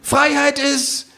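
sehr hohe, langsame Figurenstimme; Känguru. Acted. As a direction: gedehnt und pathetisch verkünden. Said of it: "sagt das Känguru". (hohe Känguru-Stimme, Tresenrede)

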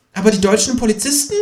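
high lecturing kangaroo voice. A narrator's reading. Kangaroo lectures on evolution in a high voice.

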